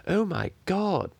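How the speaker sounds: high, slow gasping "oh my God"; shocked gasp. Retold or imitated. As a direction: gasp it like a stunned jury.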